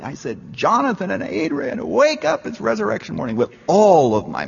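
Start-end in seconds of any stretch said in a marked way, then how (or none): 0.5-3.4 high call to the dead, straining on wake up; shouting with all my might at the graves; pitched-up call, pushed harder than the first try; louder retold shout. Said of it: "I shouted more loudly".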